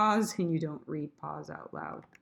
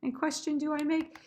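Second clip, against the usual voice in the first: crescendo; 7 semitones higher than usual. Shown as a demonstration. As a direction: lift and swell it toward BEAUTY. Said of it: "gonna be my crescendo".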